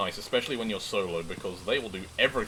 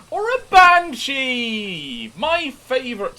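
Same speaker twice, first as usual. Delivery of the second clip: drawn-out high excited cry; 11 dB louder, slower than usual. Real, not acted. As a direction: stretch it out, thrilled. Excited gamer calls out ghost type.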